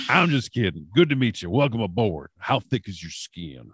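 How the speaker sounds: imitating his joshing welcome; Michael Hayes. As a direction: play the veteran ribbing a new hire. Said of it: "He's like".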